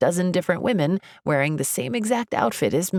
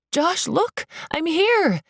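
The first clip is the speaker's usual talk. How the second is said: urgent high-pitched call; desperate, high mild shout; 12 semitones higher, slower than usual. A narrator's reading. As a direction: call out to be noticed. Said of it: "I shout".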